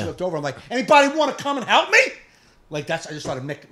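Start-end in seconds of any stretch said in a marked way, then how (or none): 0.7-2.0 high, loud mock holler; mocking a rude shopper; mimicking the customer. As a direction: parody her yelling for service. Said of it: "mimicking her".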